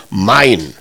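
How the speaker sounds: low, isolated "mein", set apart; stress on MEIN. Shown as a demonstration. Preacher contrasts ein with mein, low emphatic "mein". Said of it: "da haben wir so einen Buchstaben davor".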